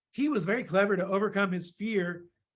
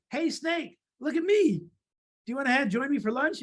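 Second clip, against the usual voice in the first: high-pitched mouse calling a snake over; bold, sing-out storybook mouse; 6 semitones higher than usual. Acted. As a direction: pipe up high to hail someone. Mouse boldly calls to snake.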